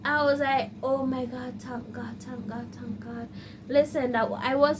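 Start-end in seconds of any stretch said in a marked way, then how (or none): 0.8-3.3 soft, breathless thanks; relieved whisper. Real, not acted. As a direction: murmur it in relief. Relief after teacher accepts.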